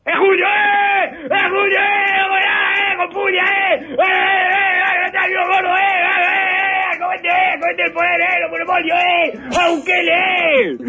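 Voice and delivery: hammed-up crowd cheer for a player; mock stadium cheering. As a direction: yell him on like a fan in the stands. Mock football chant for Julio.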